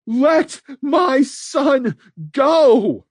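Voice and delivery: high, loud, slow desperate shout; hoarse shout. A narrator's reading. Mother shouting at Red to free her son, desperate shout. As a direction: scream for your son's release. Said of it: "Alice's hoarse shout".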